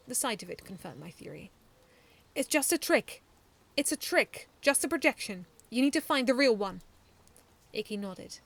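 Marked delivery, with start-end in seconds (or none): 2.3-6.8 loud, higher urgent advice; urgent call. A narrator's reading. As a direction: call out the trick.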